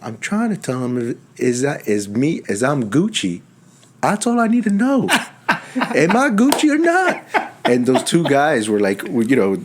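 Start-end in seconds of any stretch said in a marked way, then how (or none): 4.0-7.2 mock patient. Patient voiced in retold call.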